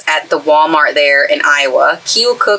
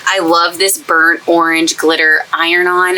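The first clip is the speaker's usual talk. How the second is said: normal talking voice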